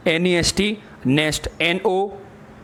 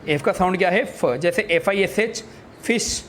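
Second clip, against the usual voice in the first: ordinary talk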